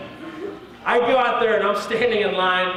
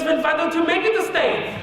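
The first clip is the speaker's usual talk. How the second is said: put-on higher-pitched German-accented official; German officer; 10 semitones higher than usual. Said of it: "I'm in Germany".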